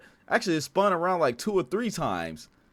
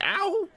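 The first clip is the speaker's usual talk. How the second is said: very high-pitched mock "ow"; imitated high wail of pain; mock crying wail. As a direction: yelp it high like a crybaby. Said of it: "They're down there crying".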